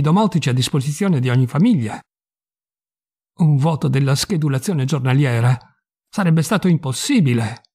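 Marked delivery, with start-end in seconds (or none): none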